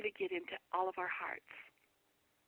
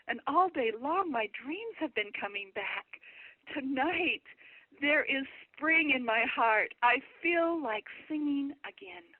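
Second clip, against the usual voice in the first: slow, swelling quote, louder and higher; hope returning; 5 semitones higher, 5 dB louder, slower than usual. Retold or imitated. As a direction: build slowly into a heartfelt declaration. Retells a man's hope returning.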